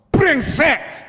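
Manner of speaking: high, sudden angry yell; acted outburst of swearing; shouted curse. Driver curses when something happens, angry shout.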